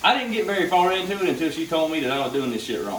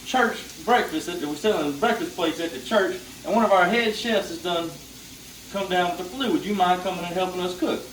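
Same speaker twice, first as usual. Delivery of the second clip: lightly voiced church lady, pitched higher; Mama T voice; 6 semitones higher than usual. Mama T asks for help at church breakfast, mildly higher. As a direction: ask the favor in a slightly higher motherly tone. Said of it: "She goes".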